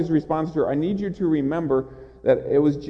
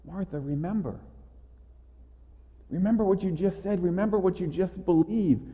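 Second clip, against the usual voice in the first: softer, tender reminding; gentle reminder; 3 semitones higher, 5 dB quieter than usual. Retold or imitated. Jesus tenderly reminding Martha, softer gentle voice. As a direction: remind her gently, like a friend. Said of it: "is one of reminder".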